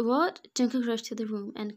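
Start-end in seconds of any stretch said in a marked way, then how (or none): 0.1-0.5 surprised. A narrator's reading. Sudden realisation of pregnancy.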